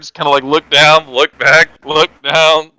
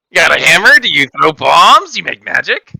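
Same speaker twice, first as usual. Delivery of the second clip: character voice; 5 semitones higher, 4 dB louder than usual. Acted. Player voicing his character asking.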